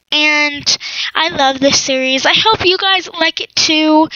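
ordinary talk, nothing marked

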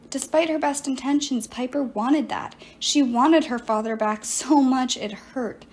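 ordinary delivery, nothing marked

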